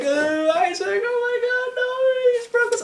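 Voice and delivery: very high, wailing falsetto imitation; panicked girlfriend. Girlfriend crying over Isaac, a high mock wail. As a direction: mimic her hysterical wail.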